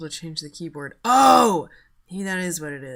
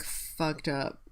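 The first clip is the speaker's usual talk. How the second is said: usual speaking voice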